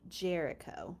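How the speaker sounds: ordinary delivery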